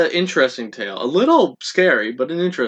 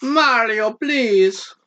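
Mario voice; 8 semitones higher, much slower than usual. Pretend. Copying the Mario voice.

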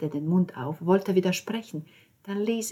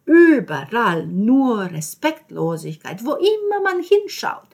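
higher, louder nagging Italian landlady; scolding landlady; 3 semitones higher, 7 dB louder than usual. Acted. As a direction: rant at her, higher and louder, indignant.

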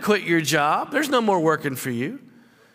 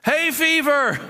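high, comic put-on voice; mock public confession; 7 semitones higher than usual. Modern person confessing an illness publicly, comic high voice. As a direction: announce the ailment like a sheepish confession. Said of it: "had to declare your illness today".

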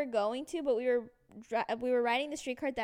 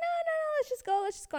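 very high imitated mother; pitched-up, dismissive mom voice; insistent mom; 3 dB louder than usual. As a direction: play mom brushing off every objection. Imitates mom brushing off objection.

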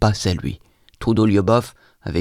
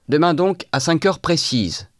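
higher pitched call, slower at the end; 8 semitones higher than usual. A narrator's reading. Simonov calling after him on the stairs, raised call. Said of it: "me cria-t-il dans l'escalier".

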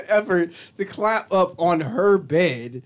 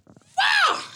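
a sudden high yell of frustration, hammed up; a retold tantrum shout, much higher; mock angry outburst. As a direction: act out losing it and shouting. Retelling his own outburst.

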